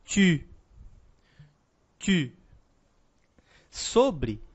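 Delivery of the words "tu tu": tu with French u, lips rounded and tongue forward; French tu, rounded front vowel. Shown as a demonstration. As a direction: say tu with pouted French u. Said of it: "eu digo".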